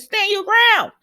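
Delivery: high, loud yell at the TV; cheering a character on from the couch; shouting at the screen. Real, not acted. Yelling advice at the TV.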